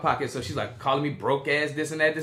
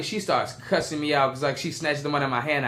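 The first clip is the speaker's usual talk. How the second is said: normal talking voice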